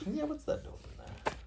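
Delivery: high whispered mock surprise; mock-puzzled squeak. Parent playfully puzzled at picture with child. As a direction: play baffled, high and breathy.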